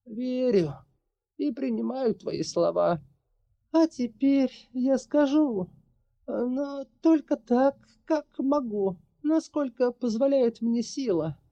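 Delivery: put-on high, measured voice of an old witch. A narrator's reading. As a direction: voice the sorceress, calm and heavy. Witch voice continues.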